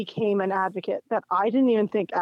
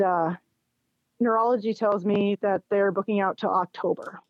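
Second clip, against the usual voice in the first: ordinary delivery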